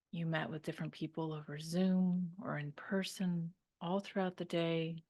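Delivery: calm meditation voice with long pauses; guided meditation. Real, not acted. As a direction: guide them to unwind, leaving room to breathe.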